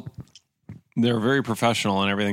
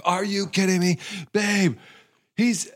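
high-pitched mock yell, no real volume; retold screaming; 10 semitones higher, slower than usual. Customer screaming down the street to his wife, mock yell. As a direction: reenact an outraged scream, pitched way up. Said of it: "I'm, like, screaming down the street".

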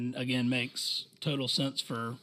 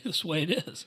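laughing through the words; 5 semitones higher, faster than usual. Real, not acted. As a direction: chuckle as you say it. Laughing while agreeing.

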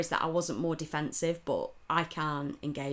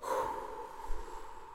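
weary sigh; 8 dB quieter than usual. Real, not acted. After retelling the insult.

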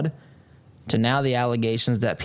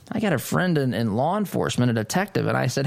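ordinary talk, nothing marked

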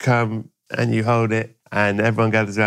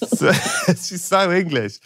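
laughing through the words; amused, half-laughing; 7 semitones higher, slower than usual. Real, not acted.

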